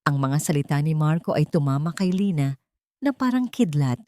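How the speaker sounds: normal talking voice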